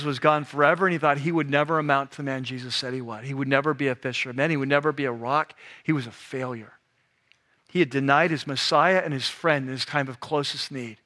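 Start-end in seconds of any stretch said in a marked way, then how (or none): 5.8-6.7 hushed grief. Acted. Sermon on Peter's shame.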